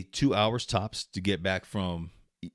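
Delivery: ordinary delivery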